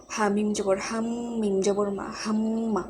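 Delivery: হাম্মা with the m hummed through the nose; nasal gunnah. Shown as a demonstration. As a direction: hold the ম in হাম্মা as a long nasal hum. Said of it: "এই মিমটাও গুণনা করে পড়তে হবে".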